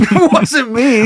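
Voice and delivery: slow, high, laughing "not me"; laughing denial. Retold or imitated. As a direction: deny it through laughter.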